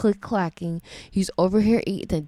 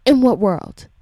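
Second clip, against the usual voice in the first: louder, quicker IN WHAT WORLD; forceful outrage; 3 semitones higher, 7 dB louder than usual. Real, not acted. Parent demanding an answer about the teacher, loud and forceful.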